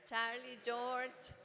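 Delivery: Charlie and George said with an American English accent; playful American English accent on the names; gringa English accent. Shown as a demonstration. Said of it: "me da por ahí medio gringa".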